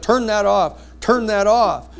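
loud, high angry order; imitating a furious judge; judge shouting. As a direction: bark the order over the room. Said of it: "starts pounding his gavel".

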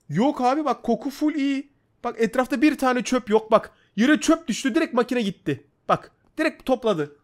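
high-pitched indignant insisting; high, worked-up protest. Real, not acted. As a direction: defend the shop, voice up high. Indignant defence against a bad review.

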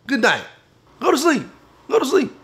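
high, loud bursts; irritated order. Acted. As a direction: order him to sleep, fed up. Acted quarrel, ordering sleep.

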